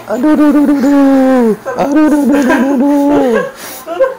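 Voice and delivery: high yelps of real pain; pained cries. Real, not acted. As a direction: cry out over and over in pain. Patient cries out during massage.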